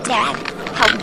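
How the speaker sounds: scared call for help. Acted. Kid being transformed, calls dad.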